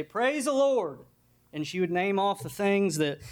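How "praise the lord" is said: high, drawn-out cry of praise; old saint's high praise; praising old woman. Acted. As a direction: call it out like a devout old lady. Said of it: "and she'd say".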